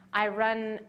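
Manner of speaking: ordinary talk, nothing marked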